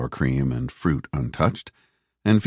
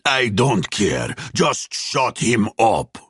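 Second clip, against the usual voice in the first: slow, raised, angry order; angry commander; 7 semitones higher, much slower than usual. A narrator's reading. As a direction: bite back as the furious commander, words drawn out. Stepan shouts back at soldier.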